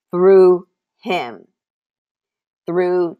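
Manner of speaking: sudden loud preacher stress; emphatic repeat. Acted. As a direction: ring it out firmly.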